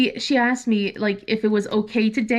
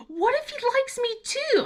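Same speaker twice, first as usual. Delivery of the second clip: put-on much higher, worried teen question; anxious daughter; 13 semitones higher than usual. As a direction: ask it like a nervous twelve-year-old. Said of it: "She goes".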